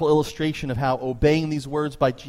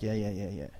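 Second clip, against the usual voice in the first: dismissive brush-off; 5 semitones lower, 9 dB quieter than usual. Retold or imitated. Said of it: "was like".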